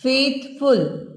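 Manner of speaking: "फेथफुल" in two separate beats; syllable by syllable. Shown as a demonstration. Teacher showing a two syllable word, "फेथफुल" split in two. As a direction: pause between "फेथ" and "फुल" in "फेथफुल". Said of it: "two syllable word".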